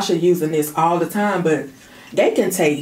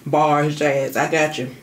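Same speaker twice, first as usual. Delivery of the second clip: usual speaking voice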